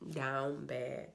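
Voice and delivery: normal talking voice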